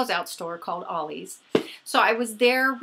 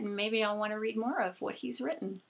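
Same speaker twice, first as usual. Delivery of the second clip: ordinary talk, nothing marked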